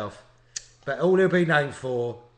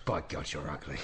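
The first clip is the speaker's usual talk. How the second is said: much lower, much softer, slurred growl; drunken Oliver Reed insult; 11 semitones lower, 11 dB quieter than usual. Retold or imitated. Oliver Reed insulting a guest on TV, low and slurred. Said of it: "What's he say?".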